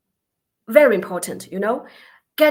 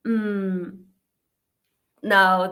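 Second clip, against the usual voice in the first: ordinary delivery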